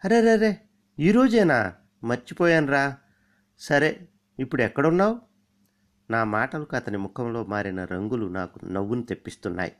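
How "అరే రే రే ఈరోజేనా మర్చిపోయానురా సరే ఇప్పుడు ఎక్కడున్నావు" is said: much higher, louder hammed-up forgetful surprise; teasing friend pretending he forgot the visit; feigned lazy innocence. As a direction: play dumb and overdo the oh-no. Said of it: "కాస్త బద్ధకం నటిస్తూ మాట్లాడాను".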